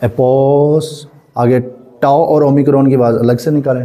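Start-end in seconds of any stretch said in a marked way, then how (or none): 0.0-1.1 एपोस drawn out very slowly; slow teacher demo. Shown as a demonstration. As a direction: stretch एपोस out syllable by syllable.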